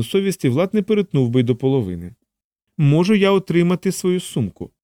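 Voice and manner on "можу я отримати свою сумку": higher voice with a touch of coldness; cold repeat. A narrator's reading. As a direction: repeat the demand with cool restraint. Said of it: "холодно повторила Анжела". (холодне повторення, вищим голосом)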